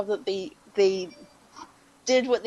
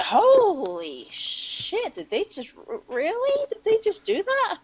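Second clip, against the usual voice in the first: drawn-out "holy", then high-pitched disbelief; retold stunned double-take, rising higher; astonished reaction; 10 semitones higher, slower than usual. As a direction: act out dawning disbelief. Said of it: "you were just like".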